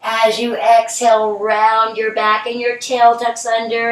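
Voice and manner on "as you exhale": ordinary talk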